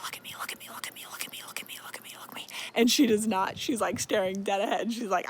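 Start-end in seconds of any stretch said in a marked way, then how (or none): 0.0-2.5 rapid, very soft, repeated whisper; monster's whisper. Retold or imitated. Monster whispering to Feyre as it passes, insistent whisper. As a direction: hiss it as an insistent creepy whisper. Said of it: "whispering to Feyre".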